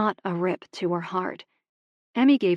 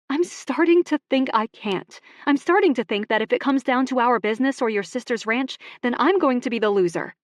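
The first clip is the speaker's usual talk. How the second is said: raised, hurt and higher pitched; upset confrontation; 6 semitones higher, 5 dB louder than usual. Acted. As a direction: push back hard, voice climbing with hurt. Emmy confronting Ox over partnership.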